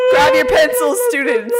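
high, loud mock-teacher call; teacher shouting. Teacher starting the final, high hammed-up shout. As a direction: holler over a rowdy class.

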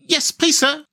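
high-pitched, respectful youngster; polite little hedgehog, voice pitched well up; respectful young hedgehog. A narrator's reading. Elder hedgehog answering the rat, high and respectful. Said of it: "said the elder of the two hedgehogs respectfully".

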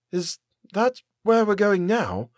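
high, slow, timid question; small voice. A narrator's reading. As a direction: ask haltingly in a small high voice. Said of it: "My voice was small".

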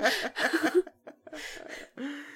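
soft, high run of chuckles; real laughter. Real, not acted. Friends joking about drywall underwater, real laughter. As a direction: laugh on after the joke, trailing away.

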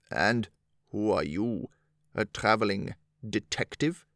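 put-on low, scoffing old soldier; low harsh voice. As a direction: sneer it out, rough and low. Soldier mocks Attercop.

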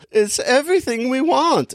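very high boy's voice with a mock German accent. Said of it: "I don't know why I'm making him German".

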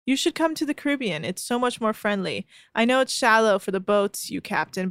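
normal talking voice

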